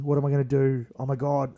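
sudden loud panic cry; panicked outburst. Retold or imitated. Wrestler panicking at his debut entrance, blurting loudly. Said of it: "I'm like".

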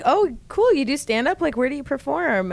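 high, chirpy mock enthusiasm; feigned excitement at an open micer; fake interest. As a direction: ask like you're thrilled, pitched well up. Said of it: "I just was like".

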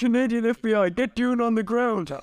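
high-pitched commanding arrest order; police bust. Acted. Officer ordering suspects down at a drug bust, high and urgent. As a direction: call out like an officer making a raid.

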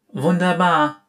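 etwas lauter vor Staunen; begeisterter Ausruf. Acted. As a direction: Oriana staunt über Fiarams Zauberei, lauter Ausruf. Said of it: "rief sie".

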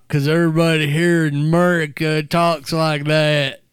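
loud, exaggerated Southern drawl; Southern American drawl. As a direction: ham up a Southern US accent. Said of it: "that Southern American drawl".

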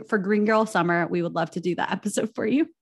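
ordinary delivery

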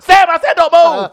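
high laughing yell; frantic shouting hostage taker. Retold or imitated. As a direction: shout it while cracking up. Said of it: "the proper reaction".

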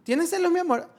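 lightly voiced teasing couple; teasing jealous exchange. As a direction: tease her about being jealous. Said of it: "como, ajá, así".

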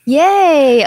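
loud happy yay; excited cheer. Real, not acted. Cheering a birthday announcement.

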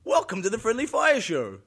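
slow, projected podcast opener; show host intro. Acted. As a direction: announce the show with big energy.

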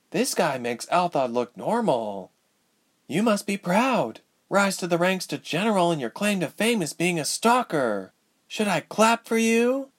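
high-pitched, bitterly mocking heroine; mock him bitterly in a high voice. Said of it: "Ilse asked bitterly".

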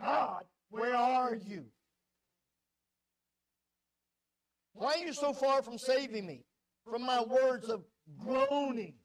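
loud, slow cry of abandonment; a pleading shout toward heaven; anguished lament. Acted. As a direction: call out to God as if forsaken. Voicing the psalmist's lament.

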